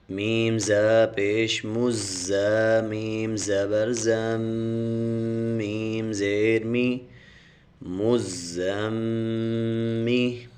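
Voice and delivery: slow, measured tajweed recitation; Quran recitation. Shown as a demonstration. Teacher spelling Quran syllables for students, slow chant. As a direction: chant each syllable slowly and clearly.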